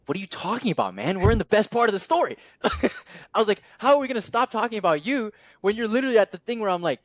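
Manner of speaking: high, animated disbelief with a laugh in it; excitedly protesting a friend who stops mid-story; incredulous excitement. Retold or imitated. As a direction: pitch way up, hooked on the story. Excited retelling of own reaction.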